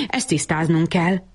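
usual speaking voice

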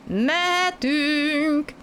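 high, stretched-out call to the front; calling forward. A narrator's reading. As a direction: call ahead to the engine, slow and high. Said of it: "kiáltott előre". (előrekiáltás, magas, elnyújtott hangon)

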